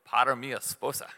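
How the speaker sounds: ordinary talk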